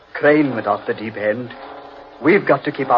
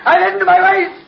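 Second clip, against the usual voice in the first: much higher, shrill terror; panicked cry for help; 4 dB louder than usual. Acted. Man sinking in quicksand crying to Humphreys, panicked. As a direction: yell in panic as you sink.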